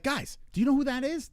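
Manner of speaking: high, urgent retold question; excited disbelief. Asking the party about the commercial, excited disbelief.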